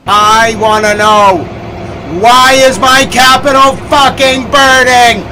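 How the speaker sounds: slow, high shout building to full yell; furious shouting. Real, not acted. Streamer demanding why the capital is burning, furious shouting. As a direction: scream the demand, stretching the words. Rant peaks in demand for answers.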